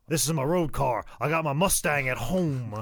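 Ricky Morton. Retold or imitated. Ricky defending his junk car, boastful. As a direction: brag it in a wrestler's voice. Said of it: "and he goes".

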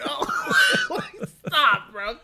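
high drawn-out wail, then dropping; hammed-up pleading with laughter; mock exasperation. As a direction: plead in comic despair. Mock exasperated reaction to Chicken.

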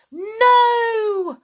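high, loud drawn-out wail; alarmed cry. Acted. Mother tries to stop Grandma drinking, panicked cry. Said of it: "cried Missus Cranky".

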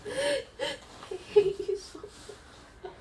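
tearful laugh, voice breaking high; laugh-crying. Acted. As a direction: laugh and sob together.

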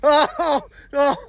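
hammed-up alarm at a ghost; acted fright. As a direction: play spooked and urgent to get away. Acted flight from a ghost horse.

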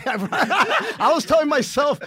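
high, loud laughing words. Real, not acted. Laughing while telling friends the story, laughing. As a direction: laugh through the line.

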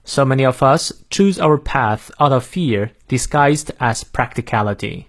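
careful American model reading; American accent. Shown as a demonstration. Teacher repeating the sentence for learners, American accent. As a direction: read it clearly in American English, cat-like a in "path". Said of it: "再来一遍".

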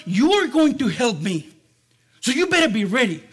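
lightly acted dad giving loud orders; commanding dad. Dad recruiting his son to help at the fence, commanding.

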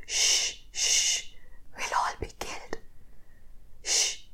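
urgent hushing whisper. A narrator's reading. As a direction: shush him in a very soft, voiceless whisper. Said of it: "I whispered".

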